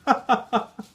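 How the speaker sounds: high, amused chuckle; hearty old man's laugh. Acted. Priest laughing at the child's fear, amused chuckle. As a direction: laugh kindly at the child's mistake. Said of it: "Yamamoto Bosan laughed".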